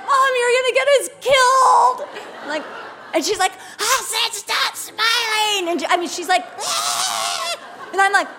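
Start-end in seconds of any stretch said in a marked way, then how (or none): 0.0-2.1 put-on high, loud alarmed plea from the kids; panicked daughters. Panicked daughters in a tense queue. 3.8-5.7 higher, slow mock threat; mock angry lady. Angry shop lady repeats her threat. 6.6-7.5 a sudden high mock shriek of rage; mock furious yell. Shop lady yells in fury.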